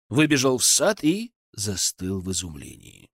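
ordinary delivery

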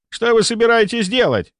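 high-pitched, bewildered demand; alarmed question. A narrator's reading. A colleague asks what the narrator intends to do, alarmed. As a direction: ask with pitch shooting up.

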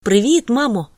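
son's carefree hello to his mother; cheery greeting. A narrator's reading.

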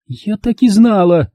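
high, slow, eye-rolling; exasperated. Acted. Lauren rolls her eyes at mother, high and slow. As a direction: sigh it out fed up. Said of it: "закатывая глаза".

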